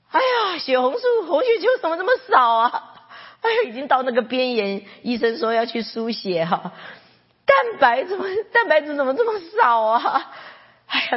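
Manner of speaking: high, loud cries of alarm; alarmed dismay. Retold or imitated. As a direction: exclaim in shocked worry over the results. Dismay reading blood test results.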